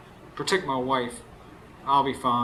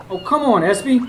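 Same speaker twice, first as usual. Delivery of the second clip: calling out; 9 semitones higher, 7 dB louder than usual. Acted. Calling his friend from outside.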